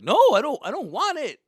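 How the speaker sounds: high, loud mock tantrum; stubborn refusal. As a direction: shout back like a stubborn son. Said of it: "I'm just like".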